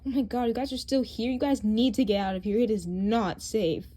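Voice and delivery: loud, fast urgent warning; frantic alarm; urgent shout. Acted. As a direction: yell at them to get out now.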